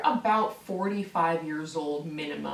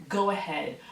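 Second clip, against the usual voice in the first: usual speaking voice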